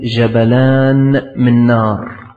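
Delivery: slow, drawn-out words of a dying man; dying man's utterance. Retold or imitated. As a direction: imitate a dying man, stretching each word slowly. Dying man's words while being prompted. (كلام بطيء ممدود كمن يحتضر)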